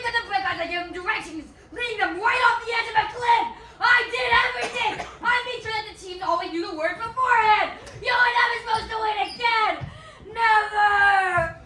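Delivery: villain's rant. Acted. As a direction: rage out the confession. Villain confesses in rage.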